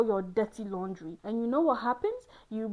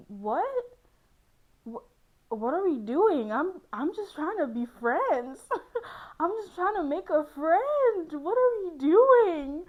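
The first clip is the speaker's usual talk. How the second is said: mock bewildered outburst; 8 semitones higher, 6 dB louder than usual. Incredulous retort to assumed romance.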